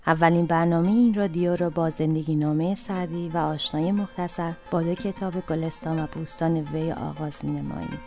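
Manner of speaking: ordinary delivery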